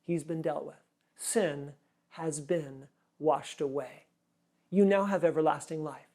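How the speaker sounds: soft, low reassuring voice; tender Jesus. Retold or imitated. Jesus gently reassuring the forgiven woman, soft and low. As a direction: speak gently as if comforting her. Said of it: "And he says".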